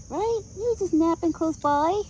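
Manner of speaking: high sing-song voice for the horse; pet talk. Real, not acted. Cooing to horse Buck.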